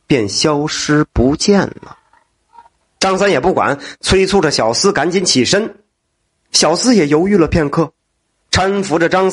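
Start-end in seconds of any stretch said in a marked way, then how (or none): none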